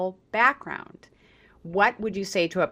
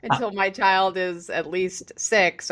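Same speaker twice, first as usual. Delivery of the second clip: higher pitch, breaking into a laugh; 4 semitones higher than usual. Real, not acted. Host joking about parents delaying math, laughing. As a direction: laugh your way into the words.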